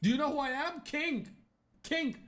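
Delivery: high-pitched mock outrage; indignant self-styled king. Offended king confronts someone disrespecting him, mock indignant. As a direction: squeak it out, affronted, high above normal voice.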